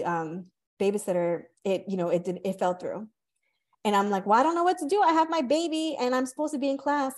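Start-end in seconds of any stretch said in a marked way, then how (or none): 4.3-7.2 mock panic. Panicked new mom retold.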